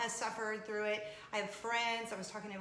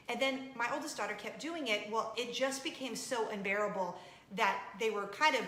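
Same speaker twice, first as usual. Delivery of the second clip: normal talking voice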